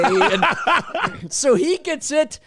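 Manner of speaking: slow words squeezed out through laughter; cracking up mid-sentence; laughing. Real, not acted. Host recalling the prank while cracking up.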